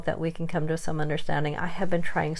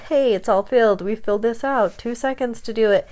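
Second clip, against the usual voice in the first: breezy insurance rep; 4 semitones higher, 5 dB louder than usual. Retold or imitated. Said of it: "my insurance called and said".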